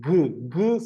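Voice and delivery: short isolated "ഗ", the hard g sound; crisp teaching demo of "ഗ"; letter sound ഗ. Shown as a demonstration. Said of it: "ആദ്യത്തെ ശബ്ദം എന്താ".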